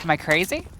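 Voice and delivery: ordinary talk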